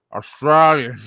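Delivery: loud, broad Australian Strine accent. Shown as a demonstration. Streamer nailing an Australian accent for chat. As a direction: belt out Australian like a drunk Aussie. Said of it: "trying to really nail the Australian".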